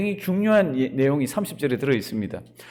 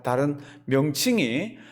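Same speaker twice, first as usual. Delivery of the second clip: ordinary talk, nothing marked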